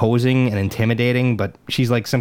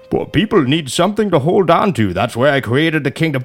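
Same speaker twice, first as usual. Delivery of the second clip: mock-regal impression, pitched up; King Ezekiel; 4 semitones higher than usual. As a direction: parody a theatrical, self-important king. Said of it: "is this King Ezekiel shit".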